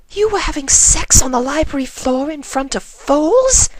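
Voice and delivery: high appalled venomous outburst; venomous hiss. Acted. Rarity scandalized at her friends, venomous outburst. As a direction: spit it out in horror. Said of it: "hissed Rarity with such venom".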